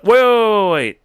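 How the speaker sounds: high, loud, run-together waits; sudden urgent interruption. Real, not acted. Stopping mid-question as a memory hits, urgent and loud. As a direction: cut yourself off with a loud, high burst.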